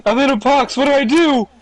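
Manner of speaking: mocking. Mocking friend's dumb question.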